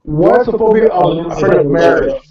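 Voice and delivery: usual speaking voice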